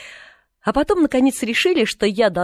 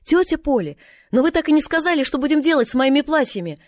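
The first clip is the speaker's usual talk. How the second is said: put-on high, raised girlish call; Pollyanna calling down; 8 semitones higher, 4 dB louder than usual. A narrator's reading. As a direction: call down to someone from upstairs. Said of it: "сверху послышался голос Полианы". (звонко зовёт сверху, высоким голосом)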